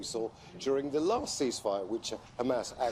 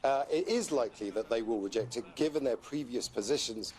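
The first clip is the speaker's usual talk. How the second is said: ordinary talk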